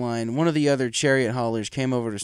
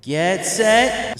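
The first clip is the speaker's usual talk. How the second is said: higher, louder starter's call; loud race call; 9 semitones higher, 7 dB louder than usual. Acted. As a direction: hold them ready at the line. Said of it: "Spike continued".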